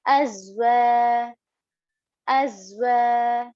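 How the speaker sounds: "Azwa" with the wa held two counts; elongated alif madda. Shown as a demonstration. Teacher showing alif madda, long held "wa". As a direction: buzz the z, then stretch the final vowel of "Azwa". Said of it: "extend it for about two counts".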